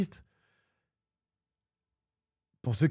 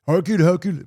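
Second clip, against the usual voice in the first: put-on loud, high Brazilian-accented call, R as H; mock Brazilian accent; 6 semitones higher, 7 dB louder than usual. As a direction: call out "recule" as "hecule", Brazilian-style. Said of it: "j'ai joué avec des Brésiliens".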